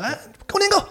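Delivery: put-on squealing high demand from a monstrous old woman; greedy crone. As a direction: screech it way up like a hungry hag. Monster granny snatching food. (妖怪老太婆尖声抢年糕)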